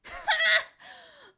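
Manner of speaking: high, loud single bark of a laugh. Acted. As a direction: bark one sharp laugh. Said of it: "barked out a single laugh".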